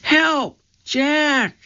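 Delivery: trapped sister crying out for help; frightened cry for help. A narrator's reading. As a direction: scream from far away in fear. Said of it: "Her cry seemed fainter".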